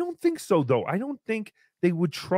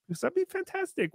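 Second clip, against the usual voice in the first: high catchphrase; 12 semitones higher, 7 dB quieter, faster than usual. Acted. Recurring catchphrase voice.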